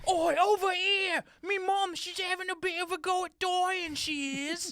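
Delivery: shouting cockney prince. Acted. The prince calling the Doctor over, in a cockney shout. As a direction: holler across in cockney.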